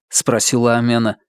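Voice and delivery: plain reading voice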